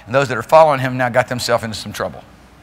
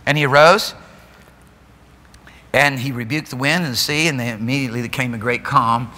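usual speaking voice

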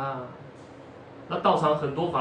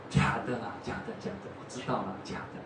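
softer, almost whispered; meek concession; 6 semitones lower, 8 dB quieter, faster than usual. Acted. Monk gives in when asked again, soft sheepish mumble.

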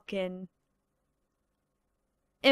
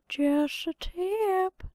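slow, soft, higher sly tip; sly cartoon aside; 6 semitones higher, 4 dB quieter, much slower than usual. Brat warning the kids, slow smug aside. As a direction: drop it like a smug little warning. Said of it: "some of these impressions".

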